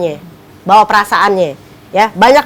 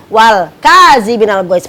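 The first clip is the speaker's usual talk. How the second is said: ordinary talk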